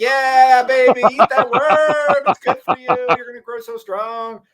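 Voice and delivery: high, loud, slow cheering goblin voice, trailing off at the end. Acted. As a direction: squeal it like a gleeful little goblin egging on a baby. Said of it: "they'd all cheer".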